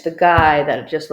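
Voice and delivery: normal talking voice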